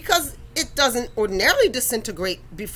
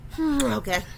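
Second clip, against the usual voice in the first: resigned angels; 4 dB quieter than usual. Retold or imitated. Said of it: "the angels in them are like".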